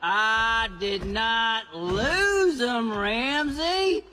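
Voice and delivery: slow, dragged-out denial; defensive. Acted. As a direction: insist slowly that it's not your fault. Defending himself against brother.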